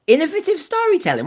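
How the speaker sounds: high, fast disbelieving echo; incredulous. Real, not acted. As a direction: scoff at the phrase in disbelief. Incredulous repeat of fan phrase.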